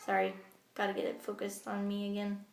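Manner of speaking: ordinary talk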